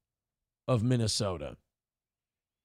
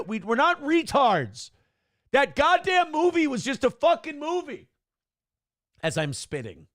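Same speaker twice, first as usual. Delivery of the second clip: raised and indignant; heated protest; 4 semitones higher than usual. Real, not acted. A Minnesotan defending his state against a movie, heated. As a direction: push back hard, higher pitched. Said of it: "defending Minnesotans".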